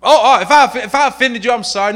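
a hint of hammed-up whining sorry; mock crying apology. Preacher mocking a fake tearful apology, hammed-up. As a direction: fake tears over a half-apology. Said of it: "you see him crying".

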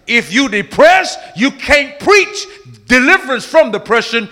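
high, loud sermon delivery; forceful preaching. Real, not acted. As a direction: drive each phrase home to the congregation.

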